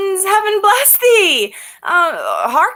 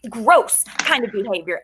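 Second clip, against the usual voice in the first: ordinary talk, nothing marked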